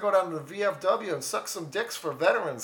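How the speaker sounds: high, folksy mock-Canadian hoser; Canadian accent. Hoser explaining his veterans outing in a high Canadian accent. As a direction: earnestly pitch it in a silly Canadian accent. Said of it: "Let out the inner Canadian".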